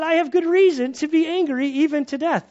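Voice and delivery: high, loud indignant snap; snapping at God. Acted. Jonah snapping back at God, high loud outrage. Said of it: "Jonah sort of snapping at God".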